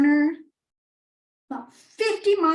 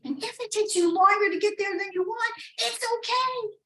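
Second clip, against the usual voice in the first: reassuring; 6 semitones higher than usual. Acted. Gentle advice.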